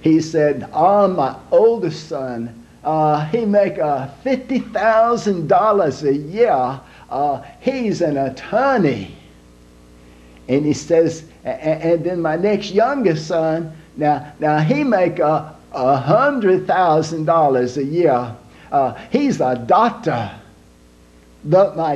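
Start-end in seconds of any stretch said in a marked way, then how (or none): 0.7-9.2 put-on slow, proud Italian accent; Italian papa boasting slowly in an Italian accent. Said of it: "an Italian man named Big Lorenzo". 11.4-20.3 put-on slow, proud Italian accent; Italian papa boasting slowly in an Italian accent. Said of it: "an Italian man named Big Lorenzo".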